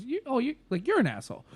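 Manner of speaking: a sudden accusing discovery; mock realization. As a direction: jump way up in pitch on the first "You". Retelling the Afterlife scene.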